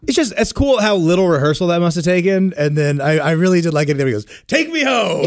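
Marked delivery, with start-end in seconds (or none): 4.5-5.3 shouting. Retold or imitated. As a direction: belt it like a singer. Quoting a singer's shouted line.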